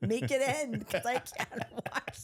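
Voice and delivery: half-laughing, pleading with her to fall; laughing, begging her to just fall; laughing inner plea. Retold or imitated. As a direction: beg through giggles for it to end. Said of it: "in my mind, I'm going".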